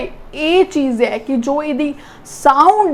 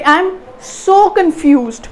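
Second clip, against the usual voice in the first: slow, stretched SO with raised pitch; heavy stress on SO; 3 semitones higher, much slower than usual. Shown as a demonstration. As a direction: push the pitch up on so, drop through confused. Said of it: "ਬਹੁਤ ਜ਼ਿਆਦਾ ਉੱਤੇ ਜ਼ੋਰ ਲਾਇਆ".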